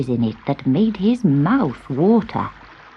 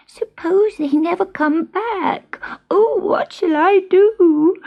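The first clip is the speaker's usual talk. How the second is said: much higher, slow whimpering whisper; a lost kitten close to tears; frightened whisper; 12 semitones higher, slower than usual. Acted. Gobelino alone in the cave, high and whimpering. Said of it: "he stopped crying".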